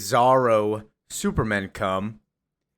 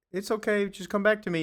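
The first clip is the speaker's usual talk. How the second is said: high Lois; 10 semitones higher, faster than usual. Retold or imitated. Lois voiced in retelling.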